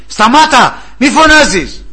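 high, loud rebuke; scolding. Acted. Bystanders rebuking the shouting beggar, high loud scolding. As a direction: shut him up sharply. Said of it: "αρχίσανε να τον επιπλήττουνε".

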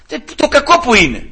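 high, loud acted exasperation; exasperated bafflement. Judge asks wife where the fault lies, exasperated bafflement. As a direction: demand an explanation as a baffled judge.

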